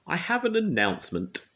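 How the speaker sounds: high, loud proclamation; grand announcement. A narrator's reading. Troublemaker announcing to the crowd, high and loud. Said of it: "announced Little Miss Trouble".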